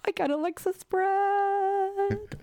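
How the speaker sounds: high, slow and savouring; delighted drawn-out high. Real, not acted. Host savours the guest's drag name, high and slow.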